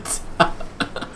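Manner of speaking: airy wheezing laugh, no voice; breathless silent laugh. Real, not acted. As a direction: laugh with no sound coming out. Laughing at co-host joke.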